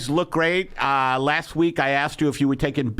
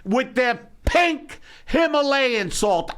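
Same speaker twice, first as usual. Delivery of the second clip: mock outrage; 5 semitones higher, slower than usual. Ranting at pampered salt users.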